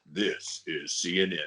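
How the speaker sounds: low, measured station-ident voice; James Earl Jones. Acted. Host recalling an old CNN voiceover, deep announcer. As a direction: intone it deep and deliberate. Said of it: "James Earl Jones did the voiceover for the".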